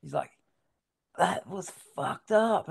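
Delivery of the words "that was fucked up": put-on slow, high, loud American accent; drawn-out praise in an imitated American accent; mock American surfer accent, impressed. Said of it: "he had that Yank accent".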